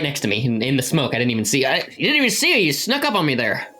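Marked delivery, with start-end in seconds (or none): none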